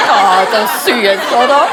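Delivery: laughing through the words; chuckling at how sweet the joke is. Real, not acted. Reacts laughing to own joke.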